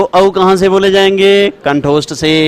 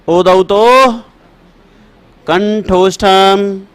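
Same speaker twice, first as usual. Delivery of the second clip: slow chanted sutra, last words drawn out; sutra recitation; slower than usual. Shown as a demonstration. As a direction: chant the rule slowly for dictation. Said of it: "दसवां लिख दीजिए".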